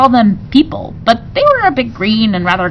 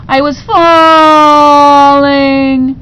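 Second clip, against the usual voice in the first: falling cry; 7 semitones higher, 7 dB louder, much slower than usual. A narrator's reading. Narrator falls through door.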